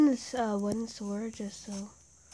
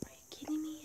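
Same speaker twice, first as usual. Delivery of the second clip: whispering; 4 semitones higher, 9 dB quieter, much faster than usual. Real, not acted. Frustrated whisper in game.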